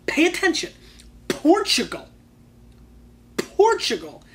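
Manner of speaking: punchy, high and loud, word by word; yelling at viewers. Real, not acted. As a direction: hammer it home to the audience. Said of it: "this is why I yell at you guys".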